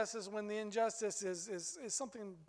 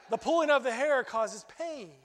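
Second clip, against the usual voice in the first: put-on smug little boy; 4 semitones higher, 9 dB louder than usual. Boy smugly explains revenge.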